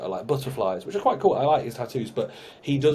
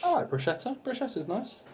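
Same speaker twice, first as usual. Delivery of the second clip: high silly voice; 11 semitones higher, 5 dB quieter than usual. Pretend. Teasing high voice.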